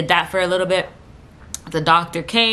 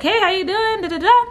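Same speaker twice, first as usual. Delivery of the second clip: very high, singsong mock greeting; chirpy doctor greeting; 3 dB louder than usual. Doctor greeting patient in labor, high chirpy imitation. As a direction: imitate a breezy doctor popping in.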